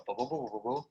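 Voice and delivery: soft tuba-like blah-blah; dad's tuba voice. Retold or imitated. Dad heard playing that tuba through the wall, soft bass mumble. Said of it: "you can hear him playing that tuba".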